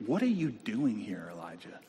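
hushed, puzzled question; God questioning gently. Acted. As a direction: ask it softly, letting it fall away. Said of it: "And God comes to him and says this".

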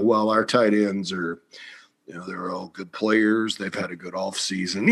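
lightly voiced unflustered coach; Saban calm reply. Saban answering the fan's question.

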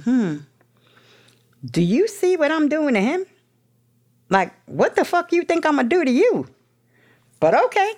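high, knowing sarcastic aside; sly inner thought. Retold or imitated. To herself about the cheating lover, high sarcastic inner voice. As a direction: think it slyly, voice climbing high.

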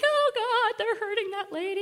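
high-pitched panic, held in; mock inner scream. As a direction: wail it high without full volume. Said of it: "inside I'm screaming".

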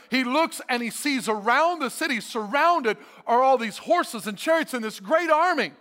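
ordinary talk, nothing marked

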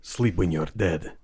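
gritty battle cry. Acted. Healer casting heal, low slow.

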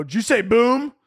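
high, startled security guard; alarmed guard. Retold or imitated. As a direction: jump on the word like a nervous guard. Security reacting to "boom.

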